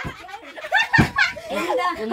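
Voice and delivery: a long, high burst of laughter; squealing laughter. Real, not acted. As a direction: crack up. Laughing after joke.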